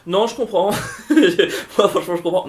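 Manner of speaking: high, loud, talking through laughter; laughing words; amused laughter. Real, not acted. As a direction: agree while cracking up. Laughing at friend's spoiler rant.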